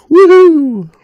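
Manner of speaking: loud, high whoop to test the voice; whooping cheer. Real, not acted. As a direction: belt out a cheer. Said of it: "It's there, it's just a little off".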